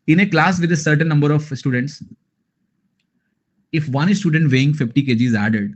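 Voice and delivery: slow, careful read of the word problem; reading the question aloud. Shown as a demonstration. Teacher reading the question to students, slow and deliberate. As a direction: spell out each clause slowly for the class.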